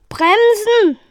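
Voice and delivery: very high, urgent yell; roaring. A narrator's reading. Pilot commands the computer during crash landing, roaring. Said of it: "brüllte Evil".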